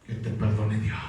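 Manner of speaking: low, cold brush-off; mock the proud refusal, dropped low; smug refusal. Preacher mocking someone refusing to forgive, low and cold.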